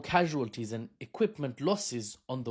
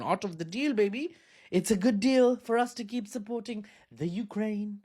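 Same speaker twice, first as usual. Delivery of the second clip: brash dealmaker voice; 8 semitones higher than usual. Retold or imitated. Voicing America as weapons merchant.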